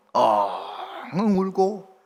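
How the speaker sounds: slow, drawn-out imitated boo-hoo; mock sobbing. Preacher imitating the boy's sobbing, slow and drawn out. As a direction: stretch 엉엉 out like a child crying. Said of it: "이 아이가 막 우는 거예요".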